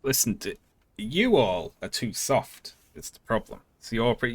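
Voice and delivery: ordinary delivery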